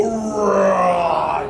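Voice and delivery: loud shouted bear roar; playful shouted bear growl. Shown as a demonstration. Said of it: "keep growling and go".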